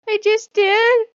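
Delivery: high, slow, smug little cat voice; a kitten voice, very high and slow, all nonchalance. Pretend. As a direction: play the cat, pleased with himself. Cat answers owner, voiced.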